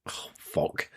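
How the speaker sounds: whispered curse under the breath. Real, not acted. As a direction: mutter it under your breath. Hiccup interrupts him.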